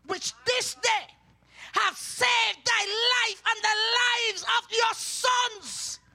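preacher's rising cry; fiery preaching. Acted. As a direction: thunder the verse out to the church. Preaching Joab's rebuke.

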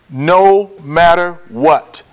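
weighty pauses between the words; slow, emphatic. Real, not acted. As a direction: drive each word home separately. Key point repeated for emphasis.

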